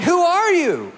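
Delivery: high, startled mock wife; wife gasping at a stranger; wife shocked her husband offers help.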